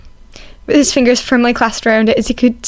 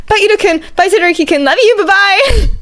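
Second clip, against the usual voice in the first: giddy goodbye; 11 semitones higher, 3 dB louder than usual. A narrator's reading. Sleepy Izuku waving goodbye.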